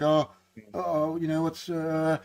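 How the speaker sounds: soft, faltering frightened dad; a hint of a nervous father, quiet and hesitant; scared dad. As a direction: fumble the words like a scared father. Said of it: "acting like this scared dad".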